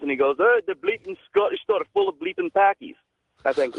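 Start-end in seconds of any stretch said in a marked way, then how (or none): 0.4-3.0 high, put-on Scottish shopkeeper sneer; mock the rude Scottish clerk. Caller imitating a rude Scottish shopkeeper. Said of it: "the guy was a little racist".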